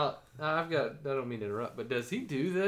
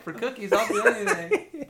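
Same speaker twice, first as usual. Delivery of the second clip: laughing; 8 semitones higher, 7 dB louder than usual. Pretend. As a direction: giggly kid bargain. Joking as kid lured.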